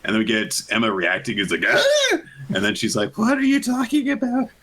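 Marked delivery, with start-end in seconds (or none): 1.7-2.2 much higher startled "Ah!"; cartoon shock yelp; shocked gasp. Emma's shocked reaction face. 3.2-4.5 much higher, disbelieving question; a wacky-faced cartoon outburst; incredulous. Emma reacting in disbelief.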